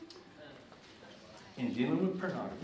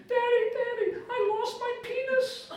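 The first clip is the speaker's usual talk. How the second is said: put-on little girl voice; 4 dB louder than usual. Father imitating his daughter by the bathtub in a high child's voice. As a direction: pipe it up like a puzzled six-year-old.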